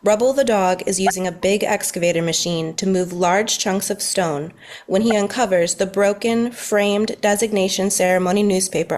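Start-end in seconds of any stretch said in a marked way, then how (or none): none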